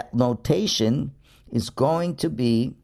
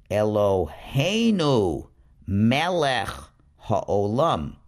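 slow, louder chanting of 'Eloheinu melech ha'olam'; slow careful reading; 2 semitones lower, 4 dB louder, slower than usual. Shown as a demonstration. Teacher reading the blessing's second line for the class, slow. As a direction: draw out each word like a first read-aloud. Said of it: "The next line would be read".

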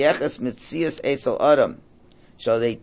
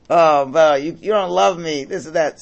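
higher and much louder, hurt and accusing; mock screaming wife; 5 semitones higher, 7 dB louder than usual. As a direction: yell it like the wife whose anniversary was forgotten. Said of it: "She's screaming at the guy".

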